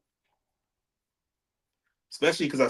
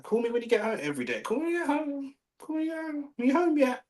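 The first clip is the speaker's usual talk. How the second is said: much higher, fast, nagging mimic of the ex; mocking panicky ex; faster than usual. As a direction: whine it up high like a clingy ex on repeat. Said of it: "she was panicking".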